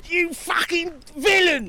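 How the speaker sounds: high, slow hammed-up indignation; captured rogue cursing his captors in a high mock fury; mock outrage. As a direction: ham up a cartoon fury. Tumpy caught and outraged.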